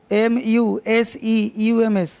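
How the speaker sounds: slow letter-by-letter dictation; teacher dictating letters; spelling out. Shown as a demonstration. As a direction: spell each letter slowly for students to write. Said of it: "teacher spelling out for dictation".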